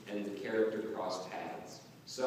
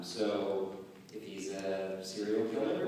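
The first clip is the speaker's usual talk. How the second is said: normal talking voice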